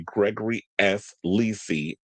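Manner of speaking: ordinary delivery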